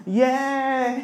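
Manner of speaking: mock happy cheer, pitched high; happy crowd's cheer, hammed up; overjoyed cheer. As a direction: celebrate with a drawn-out cheer. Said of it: "se pusieron re felices".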